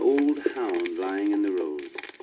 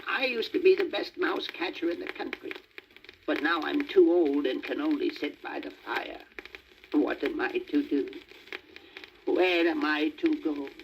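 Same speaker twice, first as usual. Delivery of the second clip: put-on higher, sorry old cat voice; mournful cat; 5 semitones higher than usual. A narrator's reading. Old cat lamenting to the donkey, mildly mournful. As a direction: plead like an old cat past its prime. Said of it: "wailed the cat".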